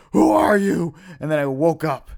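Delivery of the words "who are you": loud, high frightened cry of who are you; panicked demand. Retold or imitated. Dreamer shouting at the line of old ladies, frightened and loud. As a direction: call out in a rising panic at the ghost. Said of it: "freaking myself out, going".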